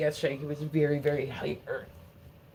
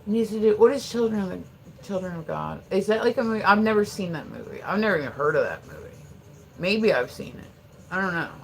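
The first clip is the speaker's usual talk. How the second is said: ordinary delivery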